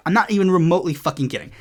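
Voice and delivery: normal talking voice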